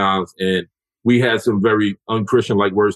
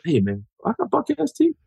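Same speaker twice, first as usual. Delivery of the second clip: worried question; 9 semitones higher, 4 dB quieter than usual. Retold or imitated. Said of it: "I said".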